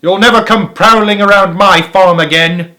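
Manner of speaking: angry yell. A narrator's reading. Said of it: "yelled Boggis".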